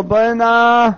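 high, loud summons; calling over. Acted. Returning scholar summons the helper, calling out loudly.

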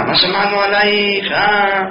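high, sing-song insinuating taunt; teasing high sing-song needling; mock gossip taunt. Gossipers taunt the poor widow.

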